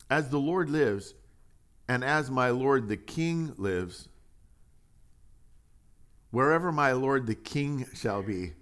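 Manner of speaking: slow, measured oath reading; solemn scripture reading. Acted. Preacher to congregation, reading Ittai's oath slowly. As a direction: read the oath with weight.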